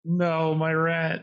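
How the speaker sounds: slow, drawn-out mock grief; stretched-out mock wail at normal volume; mock lament. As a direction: lament a lost pet, playfully drawn out. Player mourns slain pet rat.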